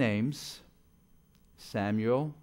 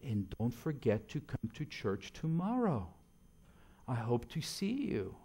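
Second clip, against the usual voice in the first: gentle reminder; 6 dB quieter than usual. Retold or imitated. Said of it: "she would remind them".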